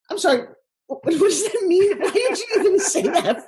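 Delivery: high, laughing disbelief; stunned disbelief. Retold or imitated. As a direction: react to the stranger in baffled, laughing shock. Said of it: "I'm like".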